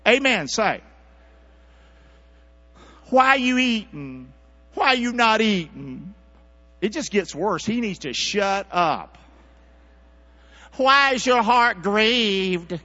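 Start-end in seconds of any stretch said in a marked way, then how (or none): 3.1-6.1 high, loud, drawn-out mock question; Elkanah. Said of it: "her husband, Elkanah, said to her". 10.8-12.8 slow, high, loud mock husband; hammed-up clueless question, every word stretched; Elkanah. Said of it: "her husband, Elkanah, said to her".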